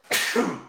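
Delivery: sudden high sneeze; sneezing. Real, not acted. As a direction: let out a real sneeze. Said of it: "I'm about to sneeze".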